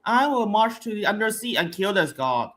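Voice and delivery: mocking a weak line reading; bad acting. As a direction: read it like a bored actress. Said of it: "she just delivered it like".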